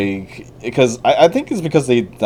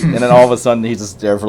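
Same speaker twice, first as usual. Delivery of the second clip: ordinary delivery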